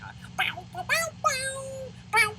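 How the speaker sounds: high mock guitar riff sung as "bow"; porn music riff. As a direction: scat the cheesy seventies guitar, way up high. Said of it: "pumping into seventies porn music".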